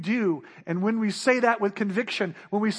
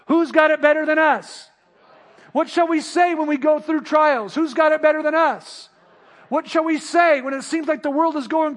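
raised, ringing chant between questions; repeated rally cry; 6 semitones higher, 5 dB louder than usual. Acted. Preacher leading the congregation's refrain, raised. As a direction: call it back each time like a refrain.

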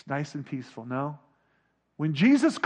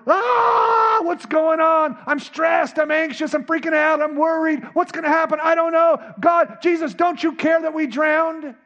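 high, loud freak-out; hammed-up anxious pleading, shouted at first; frantic panic; 9 semitones higher, 5 dB louder than usual. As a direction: cry out in mounting stress. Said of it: "in a chaotic life".

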